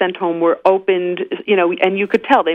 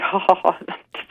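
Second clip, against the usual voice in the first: laughing; 4 dB quieter than usual. Real, not acted. Laughs at her own pun.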